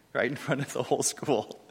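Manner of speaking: amused laugh running through the words; laughing while speaking. Real, not acted. Teacher recalling a student's public insult, laughing. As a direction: say it through a chuckle.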